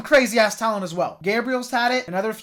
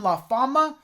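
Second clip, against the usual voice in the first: thick Spanish accent; 6 semitones higher, 3 dB louder than usual. Shown as a demonstration. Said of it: "try to say that in the thickest Spanish accent I can muster".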